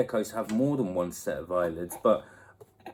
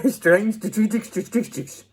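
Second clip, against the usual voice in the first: tongue-tied; 10 semitones higher, 3 dB louder than usual. Real, not acted. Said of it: "That's not how you say it".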